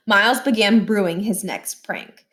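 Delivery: ordinary delivery, nothing marked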